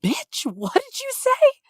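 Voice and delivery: high, slow, mock gangster challenge; menacing street-tough dare, way up high; hammed-up gangster snap; full gangster. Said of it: "I just became full gangster".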